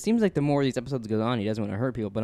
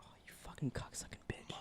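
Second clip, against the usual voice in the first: whispering; 22 dB quieter than usual. Real, not acted. Whispered curse at fly.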